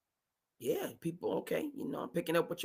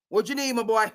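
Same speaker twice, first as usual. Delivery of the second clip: raised, higher, pushier offer; louder coaxing; 5 semitones higher, 4 dB louder than usual. Retold or imitated. Kraft pressing McDaniels openly, louder and higher. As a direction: push the offer out boldly. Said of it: "Bob Kraft said".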